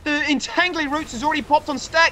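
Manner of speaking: yelling the big play; excited shout. Real, not acted. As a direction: shout it out, thrilled. Huge play in live cast.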